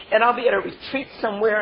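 normal talking voice

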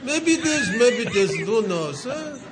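put-on a light Yiddish lilt on an old farmer's shrug; lightly voiced old Yiddish man, wry and unbothered; Yiddish accent. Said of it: "a little bit of a Yiddish accent".

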